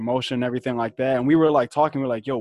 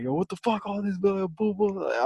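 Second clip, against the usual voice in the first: high-pitched argument imitation without real volume; mock yelling; 8 semitones higher, 3 dB quieter than usual. Retelling the neighbours' street argument to friends, mock yelling. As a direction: mimic a squabble in a high, half-volume yell. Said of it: "yelling at each other, like, full on just yelling".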